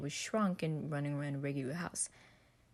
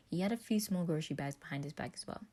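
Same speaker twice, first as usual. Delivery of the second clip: ordinary delivery, nothing marked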